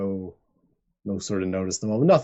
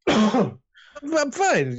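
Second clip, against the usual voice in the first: much higher, snappy denial; irritable drunk cat insisting he's fine; testy brush-off; 3 dB louder, much slower than usual. Acted. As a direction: shake off the hand. Rusty brushing off help.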